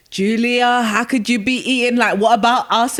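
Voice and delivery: mock angry scolding, high and loud; a hammed-up telling-off from an upset nan. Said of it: "My nan was so angry".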